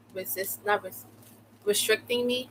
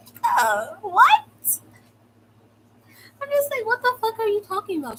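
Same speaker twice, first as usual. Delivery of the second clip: very high-pitched disbelief; acted-out astonished reaction; incredulous outburst; 13 semitones higher, 3 dB louder than usual. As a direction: squeal it in baffled indignation. Acted disbelief at his claim.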